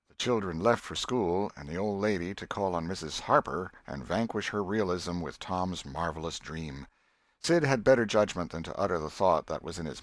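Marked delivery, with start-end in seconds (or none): none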